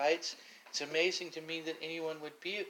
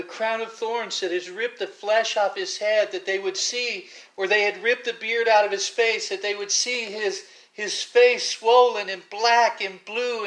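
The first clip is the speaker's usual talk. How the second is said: loud, surging sermon delivery, climbing higher; preaching at full force; 4 semitones higher, 12 dB louder than usual. Acted. Preacher to his congregation, painting the scourging, full-force preaching. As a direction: pour it on like a revival preacher describing the beaten Christ.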